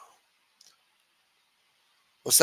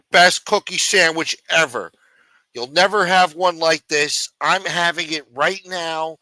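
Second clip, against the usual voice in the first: a higher mock salesman rave over cookie sandwiches; mocking Snuff; 4 semitones higher than usual. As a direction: ham up a food vlogger bragging with his mouth full. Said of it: "Maybe I should start talking like that".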